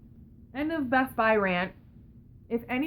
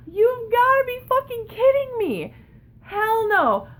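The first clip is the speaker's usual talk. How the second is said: higher, louder outraged cry; 12 semitones higher, 6 dB louder than usual. Real, not acted. Ranting to viewers about the clerk, high and outraged. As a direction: exclaim it in outrage.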